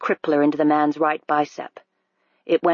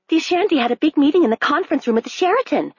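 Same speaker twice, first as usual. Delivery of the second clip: much higher, fast gossiping woman; woman on the street; 10 semitones higher, faster than usual. A narrator's reading. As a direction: rattle it off like a chatty passer-by. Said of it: "said a woman on the street".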